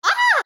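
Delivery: high, loud shriek of alarm; startled scream. Real, not acted. Reviewer startled by the show, screaming. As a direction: shriek as if jumped at.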